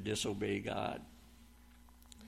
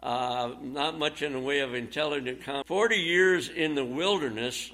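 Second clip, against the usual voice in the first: ordinary talk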